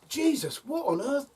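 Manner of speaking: high, startled mock shock; shocked exclamation. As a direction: imitate Alan Carr startled. Alan Carr shocked by screaming.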